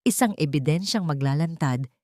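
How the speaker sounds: ordinary talk, nothing marked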